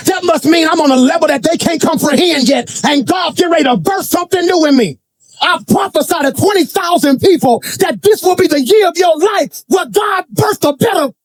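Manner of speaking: high-pitched, rousing prophetic declaration; full-force preaching. Real, not acted. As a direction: preach it high to a huge crowd.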